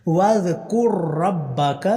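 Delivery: slow drawn-out tajweed chant; slow tarteel recitation; Quran recitation. Shown as a demonstration. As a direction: recite each vowel long and measured. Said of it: "کاف کو آگے direct را کے ساتھ ملانا ہے".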